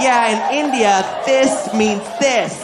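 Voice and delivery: slow, insistent hint of a child; drawn-out, emphatic touch of little girl; lightly voiced daughter. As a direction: lightly imitate a kid demonstrating to Dad. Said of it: "She's like".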